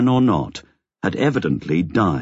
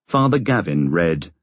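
ordinary delivery, nothing marked

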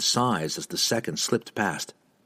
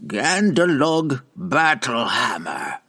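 commanding witch; 4 semitones higher, slower than usual. Acted. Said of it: "Matron Benray said immediately".

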